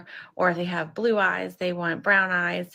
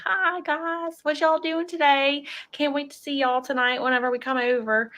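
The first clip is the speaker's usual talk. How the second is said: Southern country accent; 7 semitones higher than usual. Shown as a demonstration. Vlogger showing the Southern accent she wishes for. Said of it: "this super Southern country accent".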